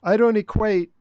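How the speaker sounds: loud, forceful denial; emphatic. Real, not acted. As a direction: push it out hard. Disagreeing firmly with host.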